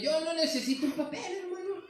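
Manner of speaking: high-pitched mock protest of a stubborn man; necio indignado. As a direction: argue it high, scoffing at the idea. Acts out stubborn opponents of marriage. (necio que protesta con voz aguda)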